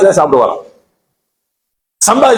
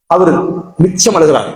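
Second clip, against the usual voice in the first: normal talking voice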